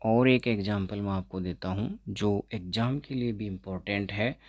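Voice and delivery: ordinary delivery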